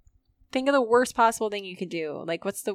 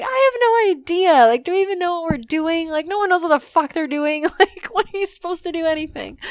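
much higher and louder, exasperated rant; frantic exasperation; 9 semitones higher, 9 dB louder than usual. Retold or imitated. Venting about adult life, a high exasperated rant. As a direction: wail it like you've given up. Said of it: "I'm like".

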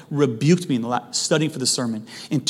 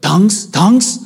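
lightly imitated Russian accent, raised and loud; mock Russian-accented questioning, pitched up; 5 semitones higher, 7 dB louder than usual. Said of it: "she had this Russian accent".

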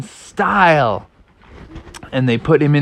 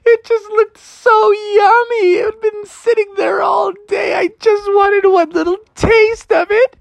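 whiny crying Froggy. Acted. Froggy confessing to dad he ate bait, high tearful whine. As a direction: sob out the excuse. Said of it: "And Froggy's like".

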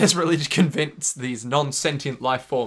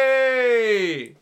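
long, high, loud cheer; drawn-out whoop of approval; 8 semitones higher, 5 dB louder than usual. Pretend. Fan cheers Iggy jumping on Polnareff, cheering.

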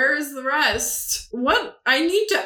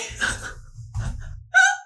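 very high, wheezy laugh; helpless laughter. Real, not acted. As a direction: collapse into squealing giggles. Collapses laughing after the reading.

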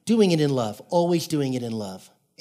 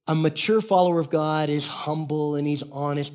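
ordinary delivery

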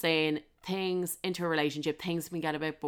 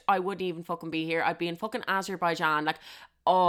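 normal talking voice

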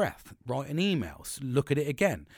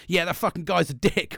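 higher, louder matey outrage; mock-indignant best mate taking his side; mate cheering him on; 6 semitones higher, 4 dB louder than usual. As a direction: egg him on like a loyal mate. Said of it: "the Nelster straight away actually goes".

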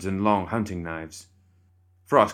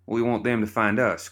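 raised, higher voice cutting in; interrupting loudly; 4 semitones higher than usual. Acted. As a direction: cut in loud over them. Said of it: "Crockett interrupted".

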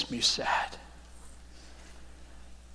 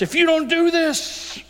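demanding Pharisee; 5 dB louder than usual. Retold or imitated.

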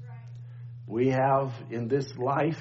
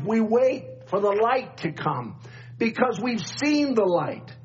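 preaching; 6 semitones higher than usual. Acted. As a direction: preach it with rising fire. Rising preaching at full force.

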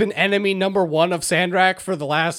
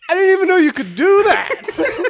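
high, loud mock astonishment; astonished outburst; 6 dB louder than usual. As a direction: blurt it in disbelief, laughter close behind. Retold astonished reply, amid laughter.

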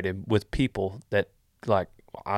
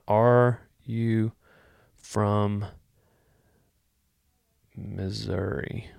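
slow drawn question; much slower than usual. Shown as a demonstration. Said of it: "That's what I'm gonna".

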